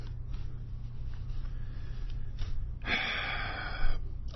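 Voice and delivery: long, soft exhale; frustrated sigh. Real, not acted. Broadcaster unsure what to do, long frustrated sigh. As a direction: breathe out slowly, at a loss.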